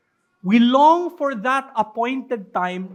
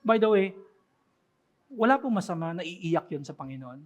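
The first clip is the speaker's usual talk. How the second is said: ordinary talk, nothing marked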